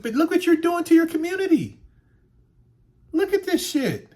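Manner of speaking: high, loud scolding of his own community; angry rant. Real, not acted. Scolding neighbors over trashed streets, high angry rant. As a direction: lash out at the neighborhood.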